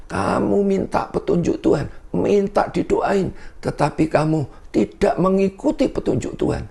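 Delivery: high-pitched imitation of a scolding pastor; angry pastor. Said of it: "Di depan rumah itu dia marah-marah".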